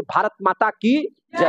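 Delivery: slow, drawn-out rallying cry; rallying slogan. Shown as a demonstration. Teacher leading the class in a patriotic slogan, rallying cry. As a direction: call it out to rouse a crowd. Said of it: "प्रेम से बोलिए".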